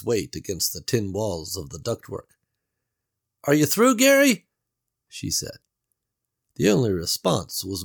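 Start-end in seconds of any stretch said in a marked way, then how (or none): 3.4-4.5 higher, louder doubtful Beverly; Beverly's voice. A narrator's reading. Beverly doubts Gary's escape plan, worried and raised. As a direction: voice her worry, raised.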